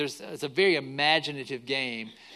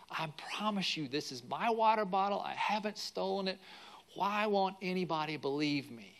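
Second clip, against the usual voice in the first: softer, higher-pitched retold plea of an upset boy; imitated tearful pleading; 4 semitones higher, 6 dB quieter than usual. Accused boy protests to teacher and chaperone, imitated tearful pleading. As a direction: plead quietly, mildly imitating a tearful child. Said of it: "burst into tears".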